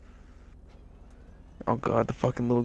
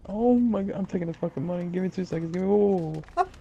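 ordinary delivery

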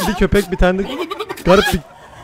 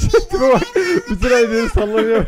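laughing hard; 6 semitones higher, 3 dB louder than usual. Real, not acted. Cracking up at cat.

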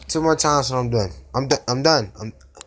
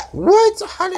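shocked squeak; 5 dB louder, slower than usual. Real, not acted. Shocked at the game.

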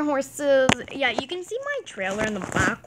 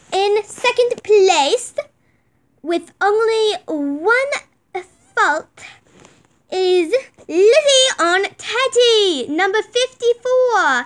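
slow, loud show announcer; 8 semitones higher, 7 dB louder, slower than usual. Pretend. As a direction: call out the placings like a ringmaster. Announcing the placings.

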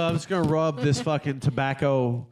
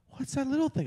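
high, small imitation of a child; curious little girl; 13 semitones higher than usual. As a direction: mimic a wide-eyed kid asking. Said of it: "She goes".

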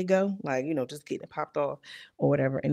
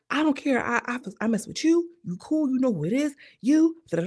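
higher, cocky bragging; hammed-up boastful; 7 semitones higher than usual. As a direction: brag like you own the room. Contestant boasting, retold.

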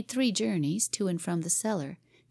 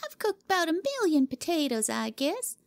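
put-on high, bored child voice dismissing potatoes; a spoiled girl waving the idea away; scornful girl; 9 semitones higher than usual. Cora rejecting Jimmy's potatoes: scornful high girl voice. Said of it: "made a scornful gesture".

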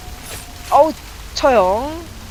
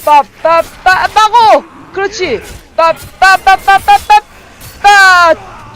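loud, high staccato "ppa" bursts; shouted hit noises; 8 semitones higher, 9 dB louder, slower than usual. Pretend. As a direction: punch out each blow like a sound effect. Voicing blows during game fight.

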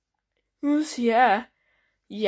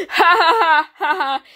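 loud laughing; 5 semitones higher, 6 dB louder than usual. Acted. Excited vacation party cheer.

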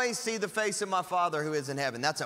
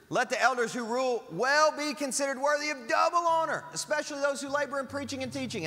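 higher, solemn Bible reading voice; raised pitch scripture recital; 8 semitones higher than usual. Acted. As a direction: read the verse out like holy writ. Reads scripture aloud.

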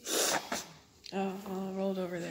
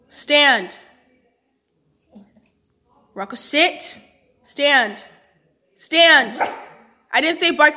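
loud firm dog command; 3 semitones higher, 11 dB louder, much slower than usual. Real, not acted.

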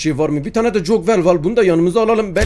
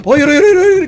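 high, loud frightened cries; panicked scream; 11 semitones higher, 7 dB louder than usual. Real, not acted. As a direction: shriek no in sudden fright. Gasp, Granny arrives.

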